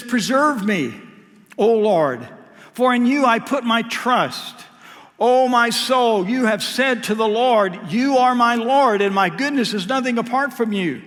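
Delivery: loud, lifted psalm reading; preaching the verse out; fervent scripture reading. Acted. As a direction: proclaim each phrase to the Lord. Reads Psalm sixteen.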